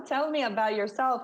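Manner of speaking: usual speaking voice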